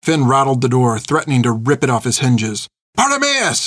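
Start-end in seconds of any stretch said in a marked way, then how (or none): 2.9-3.6 high-pitched scream at contained volume; desperate, high cry. A narrator's reading. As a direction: scream his name from high in the voice. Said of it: "she screamed".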